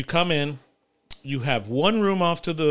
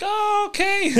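mock high-pitched voice; slower than usual. Mocking the guys' reply.

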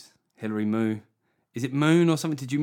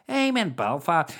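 higher-pitched mock Belfast accent; 5 semitones higher, 4 dB louder than usual. Shown as a demonstration. Comic announcing a Belfast gig, put-on Belfast accent. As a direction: put on a broad Northern Irish accent. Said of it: "gonna do the Belfast accent".

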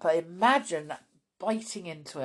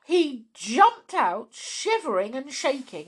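excited narration; 8 semitones higher, 7 dB louder than usual. A narrator's reading. Dramatic narration of fall.

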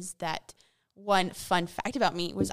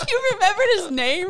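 high squealing laugh through words; laughing shriek; 13 semitones higher, 10 dB louder than usual. Real, not acted.

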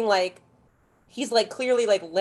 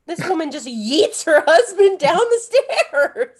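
laughing; 7 semitones higher, 6 dB louder, slower than usual. Real, not acted. Laughing while telling.

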